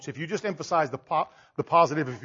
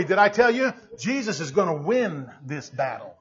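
normal talking voice

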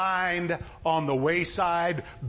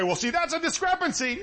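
higher-pitched mock objector; doubter's objection; 7 semitones higher than usual. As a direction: raise the pitch like someone spotting a flaw. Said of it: "And you say".

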